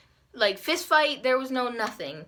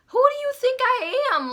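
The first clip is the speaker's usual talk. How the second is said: much higher, incredulous question; indignant; 4 dB louder than usual. Retold or imitated. Girl refusing to let her talk to her, indignant. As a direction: squeal it in mock disbelief.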